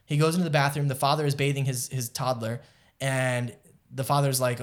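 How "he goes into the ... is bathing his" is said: usual speaking voice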